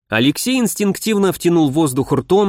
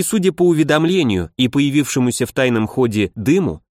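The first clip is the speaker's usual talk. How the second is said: ordinary delivery, nothing marked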